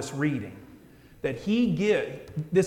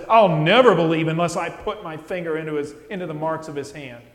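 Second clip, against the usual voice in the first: doubting Thomas; 8 dB louder, faster than usual. Retold or imitated. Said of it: "a sinner like Thomas who says".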